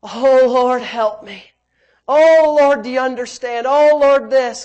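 loud, wailing "oh" in an imitated plea; hammed-up imploring; mock pleading prayer. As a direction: beg God in an exaggerated way. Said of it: "go to Him with this attitude of".

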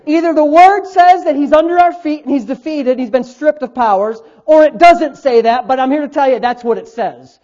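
loud, driving sermon delivery; preaching. Real, not acted. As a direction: preach it with punchy emphasis. Preaches at full force.

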